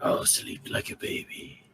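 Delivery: breathy rasping rock creature; talking rock. Acted. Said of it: "In the most rock noise voice ever".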